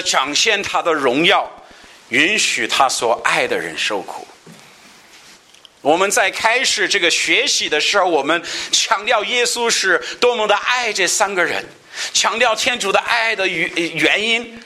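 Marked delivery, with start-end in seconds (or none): 5.8-14.6 high, intense sermon voice; impassioned pulpit delivery; fervent preaching. Acted. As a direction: preach it out with rising fervor. Preaching at raised pitch.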